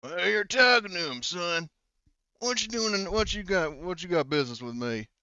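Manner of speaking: high-voiced backwoods patriarch; suspicious local. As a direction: challenge the stranger in a high country voice. Game master voices the suspicious local.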